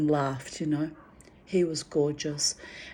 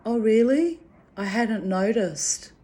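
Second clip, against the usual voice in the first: a light imitation of the wife, higher and louder on "Oh, really?"; tired wife's reply; 5 semitones higher, 3 dB louder than usual. The exhausted wife answering a compliment about her husband, in a lightly voiced mildly surprised tone. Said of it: "She said".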